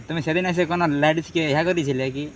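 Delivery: usual speaking voice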